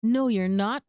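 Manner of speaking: ordinary talk, nothing marked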